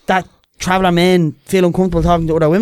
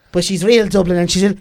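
ordinary talk, nothing marked

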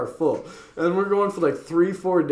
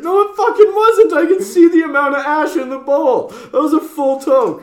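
high, loud indignant voice; mock outraged friend; 12 semitones higher, 8 dB louder than usual. Friend demanding a hit, high and loud in outrage. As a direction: squeal it like a caught-out accuser. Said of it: "And he's like".

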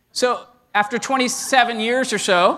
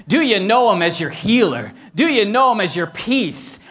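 usual speaking voice

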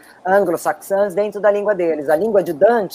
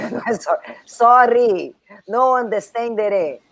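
hammed-up Italian accent, slow; jolly italian pizza chef; 4 semitones higher, slower than usual. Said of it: "sotaque italiano".

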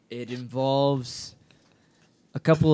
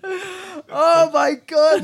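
high, loud, drawn-out wail; mock freak-out; 13 semitones higher, 7 dB louder, much slower than usual. Bleeb caught by coach in the shower, mock freak-out. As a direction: wail in exaggerated panic. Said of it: "he fucking freaks out".

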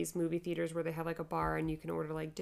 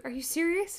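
high incredulous question; mock disbelief, pitched up; 10 semitones higher, 5 dB louder than usual. Wife hearing she must leave, incredulous. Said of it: "I was like".

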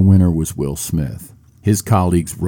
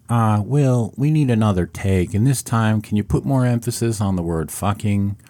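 lightly voiced film director, a bit higher; 4 semitones higher than usual. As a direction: ask for another take like a mild director. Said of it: "as if the director voice inside his head said".

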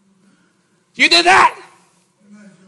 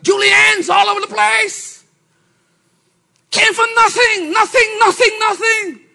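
excited; 12 semitones higher, 6 dB louder than usual. Real, not acted. Excited about rags to riches.

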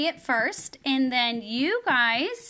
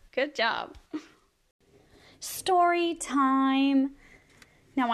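usual speaking voice

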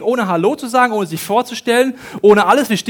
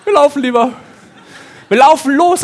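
laughing through the words; 7 semitones higher, 8 dB louder than usual. Real, not acted. Telling the church how they ran off, laughing.

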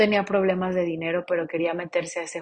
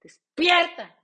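slap yourself awake with it; loud self-rousing cry of despierta; wake-up shout; 6 dB louder than usual. Shown as a demonstration. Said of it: "date un putazo y di".

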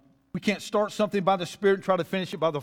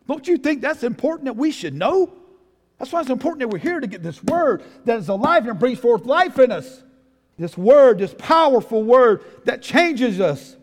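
loud, raised preaching voice; 6 semitones higher, 8 dB louder than usual. Real, not acted. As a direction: drive it home to the congregation. Preaches at full force.

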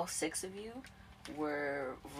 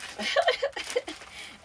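high laugh; 8 dB louder than usual. Real, not acted.

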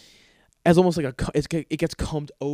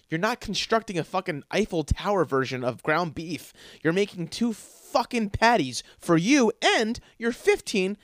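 ordinary talk

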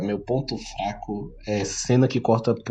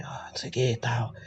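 whispered right in her ear; 10 dB quieter, faster than usual. Retold or imitated. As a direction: sly whisper close to the ear. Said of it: "dá uma sussurradinha assim no pé do ouvido dela".

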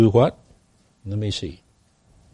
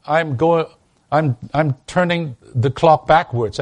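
ordinary delivery

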